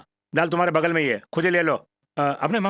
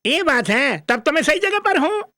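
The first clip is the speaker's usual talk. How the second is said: put-on high-pitched overgrown child; childish comic son, pleased; 10 semitones higher than usual. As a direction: exclaim with simple-minded delight, high.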